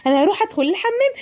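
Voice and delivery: put-on a seller hawking sweets to the children, cheerful and high; cheery candy seller.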